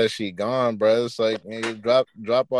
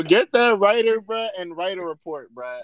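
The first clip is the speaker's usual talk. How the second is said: louder, higher joking outrage; S0 laughing call to report the writer, louder and higher; mock outrage; 6 semitones higher, 4 dB louder than usual. Real, not acted. S0 demanding someone report the show's writer, mock outraged. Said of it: "outraged joking at writer".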